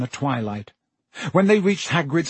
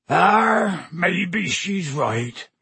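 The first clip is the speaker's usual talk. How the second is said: very slow, drawn-out rustic groan; dragging drunken concession; drunken giant; 4 semitones higher, much slower than usual. A narrator's reading. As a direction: give in with a long tipsy groan. Drunken Hagrid concedes the point.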